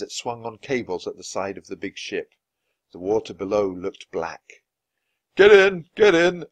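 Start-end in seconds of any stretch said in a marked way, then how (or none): 5.3-6.5 loud, high commanding shout. Acted. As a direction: bark the order urgently. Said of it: "a uniformed man shouted".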